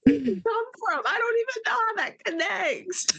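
very high, loud squeal of disbelief; amused bafflement. Real, not acted. Cohost baffled by the rump joke, high incredulous squeal.

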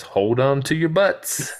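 Arnold impression. Podcaster quoting Arnold at the console, tense warning. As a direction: brace everyone for it. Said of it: "Arnold says".

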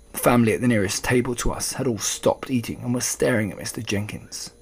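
plain reading voice

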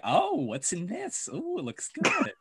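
hammed-up nosy guy peering at the shelves; nosy weird jerk. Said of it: "He's like".

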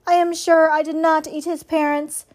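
lightly mechanical, measured denial; robot's normal voice. A narrator's reading. Robot assuring the suspicious goose, in her normal robot voice. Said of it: "returning to her normal voice".

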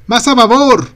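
high, loud yell; shouting. A narrator's reading. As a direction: bellow the order over the wind.

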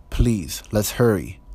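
ordinary talk

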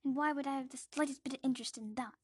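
loud, quick scorn; dismissive aunt. Acted. Aunt dismissing the boy, quick and scornful. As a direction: brush him off. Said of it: "says Aunt Petunia".

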